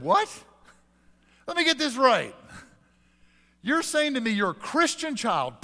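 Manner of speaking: hammed-up "you can't be serious"; mock incredulity. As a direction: play up the outrage of disbelief. Pastor's incredulous retort to caller.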